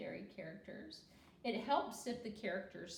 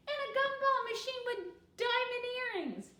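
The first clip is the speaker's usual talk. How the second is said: put-on high, louder child voice; little girl's voice; 8 dB louder than usual. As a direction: pipe it up brightly like a small girl. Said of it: "said Chelsea".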